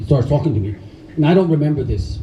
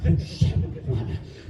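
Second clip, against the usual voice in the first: slow hushed whisper of amazement; slow whispered disbelief; awed whisper; 8 dB quieter, much slower than usual. Retold or imitated. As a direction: breathe it out slowly, stunned.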